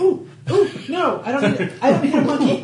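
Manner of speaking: laughing high and loud. Real, not acted. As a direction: squeal it out through laughter. Reacting to a monkey, laughing.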